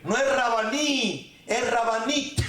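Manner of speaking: Rabanit with a clear final t; correcting pronunciation. Shown as a demonstration. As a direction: stretch Rabaní, then land the t on Rabanit. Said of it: "con T al final". (corrección lenta: Rabanit con t final)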